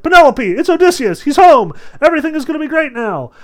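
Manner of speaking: excited nurse. Retold or imitated. Nurse telling Penelope her husband is home, excited. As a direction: blurt out the happy news like an overjoyed old nurse. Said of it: "she turns to Penelope and she's like".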